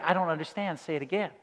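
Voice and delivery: flat polite machine voice, lightly done; a hint of the phone computer repeating itself; automated operator. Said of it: "She's like".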